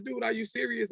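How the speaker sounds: high laughing voice, can't believe it; laughing disbelief. Retold or imitated. Asking the producer at dawn if he's serious, laughing. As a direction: ask it through a laugh. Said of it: "in my laugh, I said".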